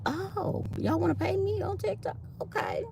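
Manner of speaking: high, soft mock surprise, trailing to a whisper. Imagining TikTok offering to pay her, high soft mock surprise. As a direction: act pleasantly shocked, then whisper the okays. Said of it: "be like".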